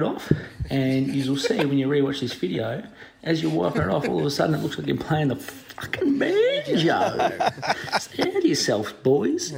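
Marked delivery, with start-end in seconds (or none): 3.2-9.5 laughing. Real, not acted. Caller laughing at his own yarn.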